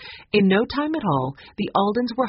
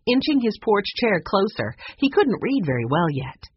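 ordinary delivery

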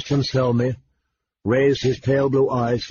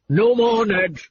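sharp high warning, voice raised; 10 semitones higher, 3 dB louder than usual. A narrator's reading. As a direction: warn him off before he says more. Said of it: "he warned".

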